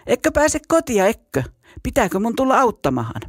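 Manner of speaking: high, quick teasing jeer; mock him like a bully; laughing taunt. A narrator's reading. Bully on the bridge mocks the scared boy, sneering and high. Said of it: "Keikuttaja vain nauroi".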